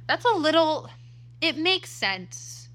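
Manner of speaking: normal talking voice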